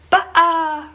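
"Ba'a" popped out loud and high; playful scare. Shown as a demonstration. Adult scaring a child through a door, high and loud. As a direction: jump out at a little child with "Ba'a". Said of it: "open the door and, like, go like".

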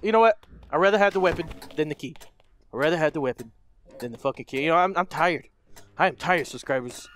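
ordinary talk

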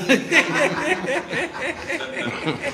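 loud high laugh; real laughter. Real, not acted. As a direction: crack up laughing. Laughing at own story.

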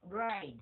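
"right" said with the teeth brought together; teeth-biting pronunciation. Shown as a demonstration. Teacher showing learners the bite, teeth-together "right". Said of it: "Cái này cũng cắn răng luôn".

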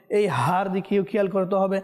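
ordinary talk

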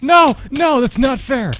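loud, high cry of unfairness; indignant gamer yell; outraged protest. Real, not acted. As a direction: protest at the top of your voice. Loses in the game.

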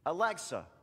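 loud, crisp mock command to a device; calling out command. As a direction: call it out to a smart speaker. Mock voice command to smart speaker.

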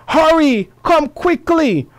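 high, urgent cry for help; exclamation. Shown as a demonstration. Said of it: "an expression of strong feeling".

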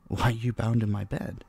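normal talking voice

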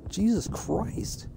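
exasperated. Real, not acted. Keeps dying.

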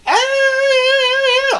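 long, high, loud mock scream; endless wave cheer. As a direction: hold one squealed cheer without stopping. Everyone stuck doing the wave.

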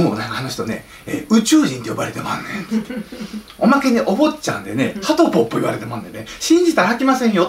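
mock Osaka insider warning people off; Kansai dialect. Insider warns the public about Hatoyama in hammed-up Kansai dialect. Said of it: "なんで関西弁出てるかわかんないですけど".

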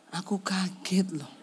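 breathy whispered aside. Retold or imitated. Husband whispering that his wife startled him. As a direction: hush it like a secret complaint.

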